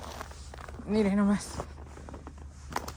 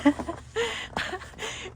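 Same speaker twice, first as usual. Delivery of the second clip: high laugh; 12 semitones higher than usual. Real, not acted. Laughs at her buried things.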